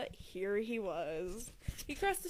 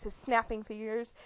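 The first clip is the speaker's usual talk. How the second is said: ordinary delivery, nothing marked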